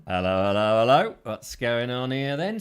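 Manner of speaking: lightly voiced stock policeman greeting; police impression. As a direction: do a mild comic British copper. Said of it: "He's doing his police impression".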